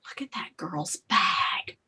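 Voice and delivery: hushed, slow gossipy whisper; imitated whisper. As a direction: whisper it slowly, as if passing on a secret. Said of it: "like, whispering".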